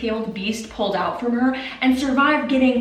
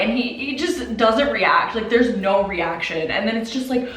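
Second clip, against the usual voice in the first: ordinary delivery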